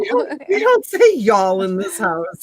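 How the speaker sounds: slow, emphatic house rule; mock scolding. Mother correcting daughter's speech at home, slow mock scolding. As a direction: lay down the law in pretend outrage.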